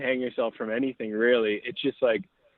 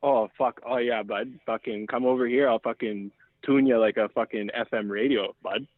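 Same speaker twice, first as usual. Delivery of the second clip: usual speaking voice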